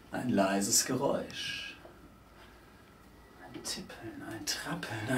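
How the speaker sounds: very soft, low, nearly whispered; hushed suspense. A narrator's reading. Narrator listening for a faint distant noise, hushed suspense. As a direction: let it shrink to a whisper.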